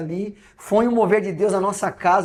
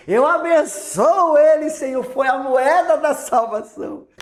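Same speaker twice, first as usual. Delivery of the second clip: joyful blessing; 9 semitones higher, 7 dB louder than usual. Real, not acted.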